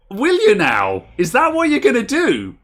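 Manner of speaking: loud, high-pitched incredulous comeback; mocking disbelief. Shop owner answering a scammer's death threat, incredulous. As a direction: challenge the threat with rising ridicule.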